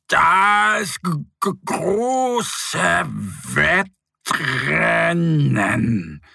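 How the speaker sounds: stammering, very slow spelling-out; little bear reads the flyer aloud, stumbling slowly; halting read-aloud. A narrator's reading. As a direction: sound out the word haltingly like a learner. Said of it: "Dann liest er vor".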